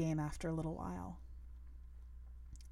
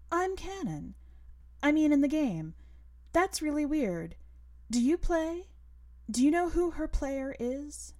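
put-on higher, louder excited girl voice; girl character voice; 7 semitones higher, 5 dB louder than usual. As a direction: play an eager schoolgirl, rushing her questions. Narrator voices the girl Cannon.

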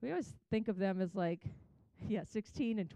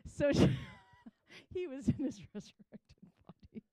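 words broken up by laughter; laughing; 3 semitones higher than usual. Real, not acted. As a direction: crack up while trying to finish the joke. Cracking up at own joke.

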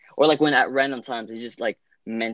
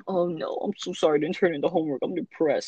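mock whiny excuse; 6 semitones higher than usual. Mocking excuse for homework.

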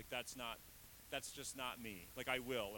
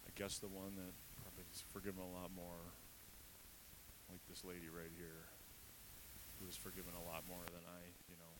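lower, softer, a touch sheepish; grudging admission; 6 semitones lower, 9 dB quieter than usual. Retold or imitated. Simon admitting to Jesus who is forgiven more, low and soft. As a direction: concede it reluctantly, under the breath. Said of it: "He's like".

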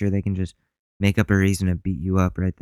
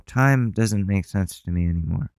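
ordinary delivery

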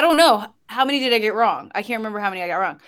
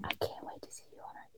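breathy whisper right in the ear; 22 dB quieter than usual. Pretend. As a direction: whisper sweet nothings softly. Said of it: "This is my sweet nothings".

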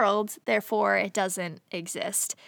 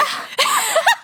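loud high laugh; 5 dB louder than usual. Real, not acted. Laughing at the movie twist.

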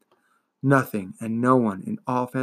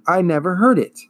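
loud; 6 semitones higher, 6 dB louder than usual. A narrator's reading. Atreyu answers loudly.

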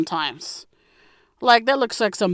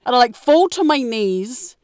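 ordinary talk, nothing marked

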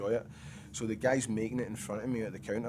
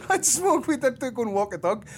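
high, loud laughing talk; cracking up while listing; 8 dB louder than usual. Real, not acted. Laughing at own routine.

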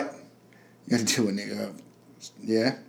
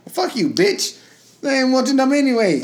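imitating a rejected man; 8 semitones higher than usual. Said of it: "we shouldn't be saying, you know".

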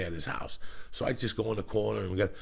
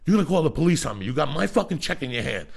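heated mock argument; 5 semitones higher, 5 dB louder, faster than usual. Retold shouting match in car.

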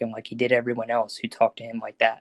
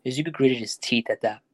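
plain reading voice

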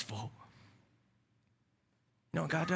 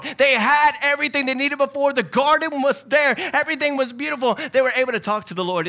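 excited preaching; 11 semitones higher, 6 dB louder than usual. Real, not acted. Preaching emphasis, garden.

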